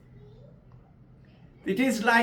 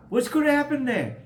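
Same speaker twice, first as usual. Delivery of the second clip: lightly acted, high-pitched eager question; curious questioning; 8 semitones higher, faster than usual. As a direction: ask it high, as the curious little wave. Wave questions friend in story.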